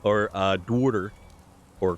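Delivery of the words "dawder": imitated Bronx "dawder"; dad's Bronx accent. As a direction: let dad's old Bronx vowel slip out. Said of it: "lost most of his accent".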